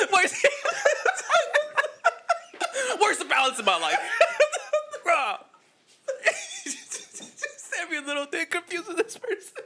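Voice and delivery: high squeaky voice, cracking up throughout; giggling mock voice of a confused person; laughing character voice. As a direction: voice a baffled character while laughing.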